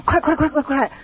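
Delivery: put-on high, urgent rushing of a child; mock nagging to hurry up; a parent's hurry-up, pitched high; impatient hurrying.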